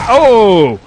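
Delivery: sudden loud startled oh; startled yelp. Real, not acted. Gamer shot from behind, loud startled cry. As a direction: jolt of real surprise as the bullet hits.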